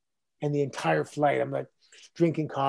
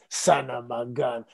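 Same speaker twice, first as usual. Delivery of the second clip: lower, slow Eastern European neighbor accent imitated; 4 semitones lower, slower than usual. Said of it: "He's the guy that says".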